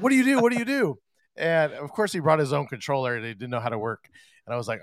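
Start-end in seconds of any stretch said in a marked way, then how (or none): 0.0-1.0 panicked artist. Retold or imitated. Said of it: "he's getting pissed at me".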